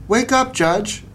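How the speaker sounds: put-on a high child's voice rousing the judge; Missy wakes the sleeping judge; bright child's call up high; little girl calling.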